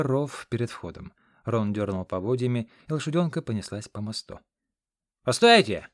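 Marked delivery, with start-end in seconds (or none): 5.2-5.9 loud call to wait; shouting after them. A narrator's reading. Aide calling after the departing cart, loud. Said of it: "крикнул Хорёк вслед".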